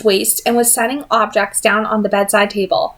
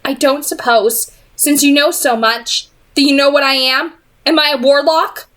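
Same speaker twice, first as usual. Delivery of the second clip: sharpened by disappointment; 6 semitones higher than usual. A narrator's reading. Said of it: "Disappointment sharpened her voice".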